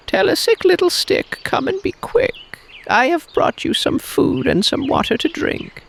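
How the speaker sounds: very high, sweet imitation of mother; sweet fake mother. Snake with new throat imitating mother, very high and sweet. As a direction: sing it out sweetly to fool him.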